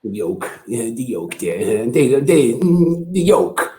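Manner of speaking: lightly voiced dad, halting and searching; dad impression. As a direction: fumble for the word like dad. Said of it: "Go ahead, do dad".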